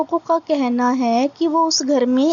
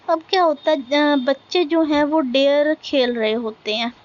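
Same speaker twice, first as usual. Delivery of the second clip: normal talking voice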